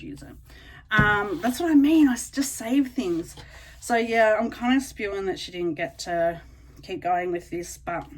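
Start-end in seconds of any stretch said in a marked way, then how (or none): none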